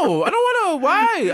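high whining protest; mock child's high whine; whiny child. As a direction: whine like a kid refusing food. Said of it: "I'm like".

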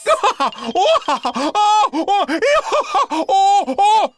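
high, prolonged, tortured laughing fit; agonized laughter. A narrator's reading. Professor tormented by tickling tentacles, agonized laughter. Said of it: "It was agonized laughter".

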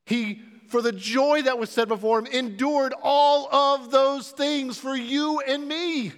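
loud, high, driving sermon delivery; rising pulpit intensity, pressing each point; full-force preaching. Acted. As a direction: preach it out with fire to the congregation. Preacher's climax on the cross.

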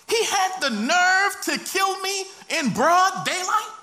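high-pitched, outraged accusation; indignant outcry. Acted. Murdered man accusing his brother before God, indignant outcry. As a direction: cry out in disbelief at the offence.

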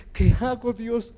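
high, loud desperate appeal; retold desperation. Preacher retelling his cry to God, desperate. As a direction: cry out to God, reliving helplessness. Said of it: "corrí desesperado".

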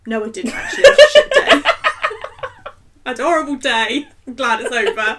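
laughing. Retold or imitated. Retold honest answer, laughing.

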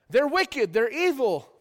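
ordinary talk, nothing marked

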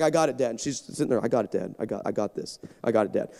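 put-on low, soft teen brushing dad off; quiet daughter voice. Daughter quietly brushing off her dad before going live.

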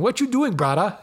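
high, playful pidgin jab from a listener; mock Hawaiian pidgin. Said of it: "I'd be getting emails going".

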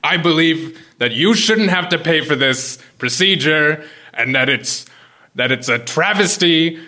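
loud, high, rising fury; heated rant. Acted. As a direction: shout it at the patient.

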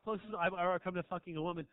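normal talking voice

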